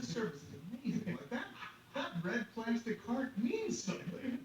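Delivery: high-pitched, stretched-out mock wonder; mock amazement. Passenger imagining airline cares about him, amazed. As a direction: gush as if astonished by the service.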